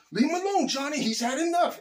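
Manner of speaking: high-pitched mock movie plea; movie character impression. Quoting the Karate Kid scene to friends, high-pitched imitation. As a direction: draw it out high, imitating a film bully's pal. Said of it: "remember the scene in Karate Kid".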